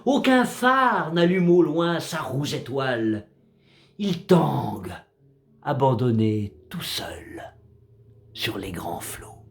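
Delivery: slow, solemn verse reading with measured pauses; poem recitation. Acted. Narrator recites a sea poem about a drifting wreck, measured recitation. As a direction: recite it as poetry, line by line, falling at the ends.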